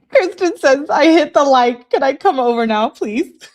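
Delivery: high, loud, laughing read; barely holding back laughter. Real, not acted. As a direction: read it out while cracking up. Reads message while cracking up.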